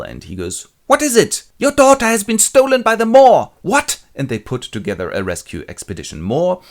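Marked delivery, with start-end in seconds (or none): 0.9-1.4 high, loud startled call; alarmed shout. Acted. Father roused by an alarm. 1.6-3.5 very high, loud alarm cry; hammed-up shrieked warning; raising hell. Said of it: "raises hell". 3.6-4.0 high, loud rising yelp; hammed-up father's shock; shocked outburst. Father hears shocking news.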